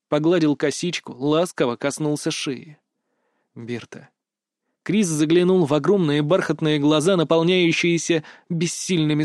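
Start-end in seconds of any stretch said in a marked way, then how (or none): 3.5-4.1 very soft, low call of her name; tender murmur. A narrator's reading. Kris strokes Berta's braid, calling her tenderly and very softly. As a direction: breathe the name gently and low.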